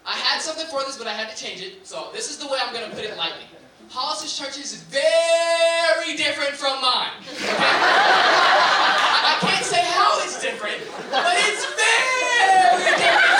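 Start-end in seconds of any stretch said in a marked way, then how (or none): 3.9-13.3 slow, loud, laughing insistence; loaded understatement. Acted. Comic telling a crowd his friend's church is very different, slow and loud. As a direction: lean hard on very different.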